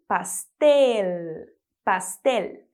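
pastel with an exaggerated final L; pastel, L held and overdone. Shown as a demonstration. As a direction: lean hard on the L in pastel. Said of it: "Presta atenção no L mais exagerado".

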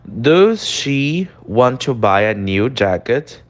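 slow, careful English model; teacher reading the example aloud; slow model sentence. Shown as a demonstration. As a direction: read it slowly for the class.

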